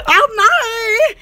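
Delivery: ordinary delivery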